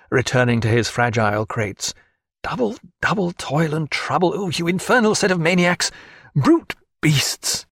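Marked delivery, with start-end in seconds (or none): 2.4-7.6 higher, fuming at the crew; a lightly voiced outburst of annoyance; exasperated naturalist. A narrator's reading. Naturalist cursing the drunken helpers, higher and exasperated.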